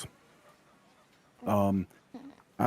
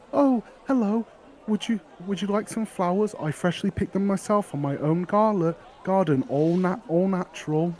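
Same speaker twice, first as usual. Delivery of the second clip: put-on much higher, sweet young seller's voice; a cheerful girl pushing her flowers; eager flower girl; 9 semitones higher than usual. Flower girl offering her flowers to the adventurer, high and eager.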